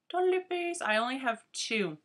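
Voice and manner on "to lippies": much higher and bright with delight; excited reveal. Real, not acted. Vlogger revealing her lipstick favourites to viewers, with an excited announcement. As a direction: announce it like a prize. Big reveal of next section.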